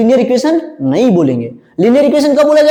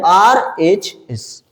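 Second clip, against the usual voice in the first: "RHS" as R, H, S with pauses, lower; letter by letter; 4 semitones lower, much slower than usual. Shown as a demonstration. Teacher names the other side for class, letter by letter. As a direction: slowly dictate each letter on its own.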